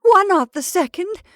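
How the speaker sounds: high, loud, eager question; frantic, hopeful insistence; feverish. A narrator's reading. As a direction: press feverishly for an answer. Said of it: "said the old woman feverishly".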